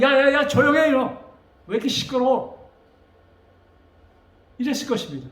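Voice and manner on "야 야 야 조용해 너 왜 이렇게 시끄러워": loud, higher-pitched hushing from a crowd; annoyed scolding. Acted. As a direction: shush a noisy beggar, loud and sharp. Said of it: "사람들이 시끄럽다고 와서".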